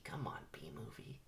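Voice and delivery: very soft, voiceless whisper; whispered aside. Real, not acted. Reader urges the movie along, whispered. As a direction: drop to a whisper under your breath.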